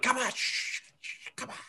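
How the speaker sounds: hushed shh, come on; urgent whisper. Acted. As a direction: whisper him along. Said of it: "I'm like".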